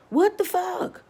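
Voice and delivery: sudden high squeal of disbelief; high, startled outburst; shocked. Real, not acted. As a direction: blurt it out, stunned. Reacting in disbelief.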